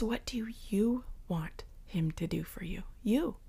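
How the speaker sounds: soft intimate whisper. Real, not acted. Preacher whispering the question to each listener. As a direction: lean in and whisper it.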